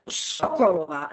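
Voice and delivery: Sokolova with SO stressed, the rest lighter; stress on first syllable. Shown as a demonstration. Teacher modelling a surname for students, first-syllable stress. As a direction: lean on the SO of Sokolova. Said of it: "again, the stress on the first syllable".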